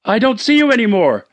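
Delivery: lost and scared in the dark; frightened wife. Acted. Wife answers husband out of darkness, frightened.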